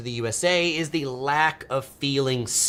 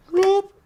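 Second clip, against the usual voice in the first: short, high, loud whoop; sudden playful yelp; 7 dB louder than usual. Real, not acted. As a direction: let out a quick high whoop. Playful noise between topics.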